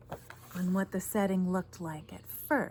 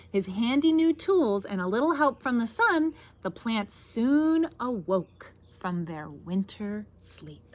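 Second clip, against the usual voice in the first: picture-book narration; 5 dB louder than usual. A narrator's reading. Reader tells children how plants woke, expressive storytelling. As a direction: build the hope, then settle gently.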